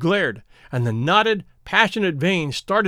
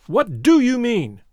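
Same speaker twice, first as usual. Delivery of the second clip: insistent demand; 6 semitones higher than usual. Acted. Sterndale pressing, rising anger.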